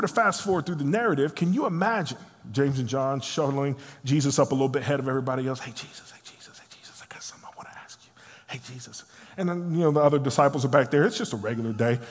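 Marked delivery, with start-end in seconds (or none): 5.6-9.0 urgent hushed whisper, tumbling out quickly; furtive whisper. Acted. James and John pulling Jesus aside from everybody else, furtive whisper. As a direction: whisper insistently for private attention.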